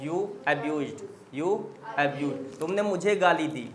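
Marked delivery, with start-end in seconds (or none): 1.3-2.6 slow, drawn-out repeat; slow model repeat; slow dictation. Shown as a demonstration. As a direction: say it again word by word for the class. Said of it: "teacher repeats slowly".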